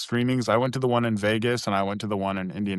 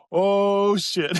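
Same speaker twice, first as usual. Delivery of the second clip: a sudden sinking surprise, voiced high; retelling the moment it hit him, in mock alarm; mock dismay; 9 semitones higher than usual. Realizing everyone else is so good.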